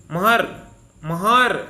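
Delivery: महर said loud and detached to show the अर; teacher stressing महर to reveal the अर sound; isolating a syllable. Shown as a demonstration. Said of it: "तो इसमें क्या आ रहा है".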